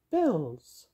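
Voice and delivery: high-pitched fairy voice; tiny fairy, high register. A narrator's reading. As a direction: pipe it like a little fairy. Fairy figure's line in story.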